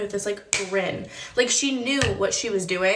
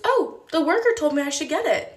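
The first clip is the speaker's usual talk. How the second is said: higher little-kid voice, put on; fibbing little girl; 7 semitones higher, 3 dB louder than usual. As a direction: imitate a child's high-pitched innocent act. Retold little girl lying to mother.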